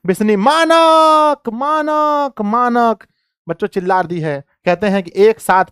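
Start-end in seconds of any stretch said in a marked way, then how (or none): 0.4-3.1 high, long drawn-out scream; mother screaming for her son; screaming his name. Acted. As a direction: cry out his name in anguish. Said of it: "चिल्ला उठती है".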